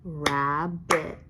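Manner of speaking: Rabbit in two separate beats, "Rab - bit"; split into syllables. Shown as a demonstration. Teacher clapping the word with pupils, split into syllables. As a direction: clap out Rabbit, one even beat per syllable. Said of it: "Let's clap".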